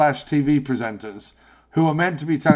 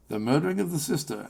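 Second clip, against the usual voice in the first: lightly parodied newsreader line, quick and softer; mock TV journalist; 8 dB quieter, faster than usual. Podcast host mocking a TV journalist, lightly stilted reporter voice. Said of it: "Nobody talks like that".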